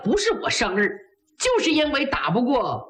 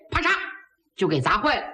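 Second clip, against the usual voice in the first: ordinary delivery, nothing marked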